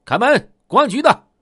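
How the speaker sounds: high, forceful police shout; loud command. Acted. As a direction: bellow an order through a locked door. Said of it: "高声喝道".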